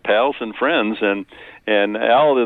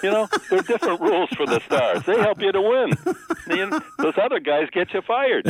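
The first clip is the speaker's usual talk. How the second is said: coach's voice; 10 semitones higher than usual. Retold or imitated. Quoting Al's coaching rules.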